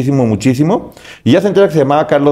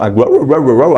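dog barking; slower than usual. Retold or imitated. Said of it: "algo así les dijo".

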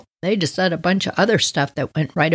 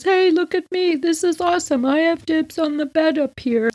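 put-on higher, excited little boy voice; little brother; 9 semitones higher than usual. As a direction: play the bragging little brother up high. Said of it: "He goes".

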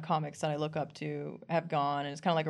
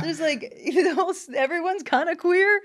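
high, loud, laughing amazement; retold giddy revelation; amazed discovery; 13 semitones higher, 9 dB louder than usual. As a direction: marvel out loud through a laugh.

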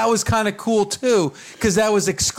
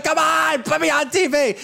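eager, raised pitch to get on camera; animated; 7 semitones higher than usual. Retold or imitated. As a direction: wave for the camera, pitched up and keen. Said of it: "And I'm being animated".

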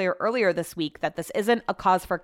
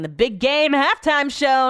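higher, punched-up radio plug; radio announcer voice; 7 semitones higher, 5 dB louder, slower than usual. Acted. Host quoting their own radio habit, hammed-up announcer voice. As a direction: sell it like a radio promo.

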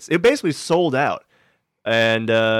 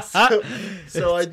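usual speaking voice

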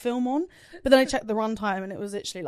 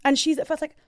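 normal talking voice

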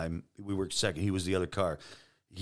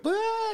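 very high mock cry of a wife; imitated distraught wail. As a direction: break into a brief high-pitched wail, imitated. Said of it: "'cause she thinks I'm dead".

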